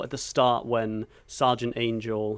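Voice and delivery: normal talking voice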